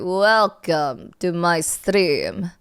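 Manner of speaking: slow, put-on Russian accent. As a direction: greet the stream slowly in a mock Russian accent. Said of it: "I don't know why I'm talking in a Rus-, Russian accent".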